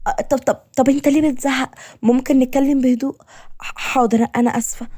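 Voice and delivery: high, hesitant, placating voice; frightened pleading. Acted. Hostage asking her kidnapper to talk calmly, frightened pleading. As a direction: stammer it out meekly, pitched high.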